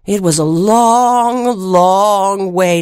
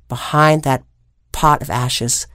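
usual speaking voice

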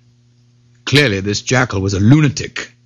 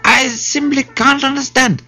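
high, confused jackal voice; crying jackal; 12 semitones higher than usual. A narrator's reading. Jackal admitting he can't follow the story, high cry. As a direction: whine high as a baffled jackal. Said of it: "cried the Jackal".

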